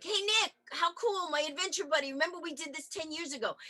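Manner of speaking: high, bright-eyed excited hello; excited greeting. Retold or imitated. Reminding adventure buddy Nick of their trip, excited. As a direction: gush at an old friend. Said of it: "So I was like".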